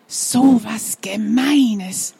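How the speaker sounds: whispered thought; slow whispered inner voice. Acted. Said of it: "denkt Basti".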